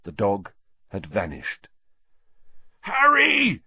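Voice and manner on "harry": high, loud desperate cry across the pitch; anguished yell. A narrator's reading. Wood warning Harry from the goalposts, anguished yell. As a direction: yell his name in anguish. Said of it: "came Wood's anguished yell".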